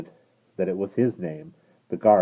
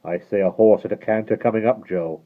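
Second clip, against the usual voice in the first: coachman voice; 4 semitones higher, 8 dB louder than usual. Acted. Coachman listening.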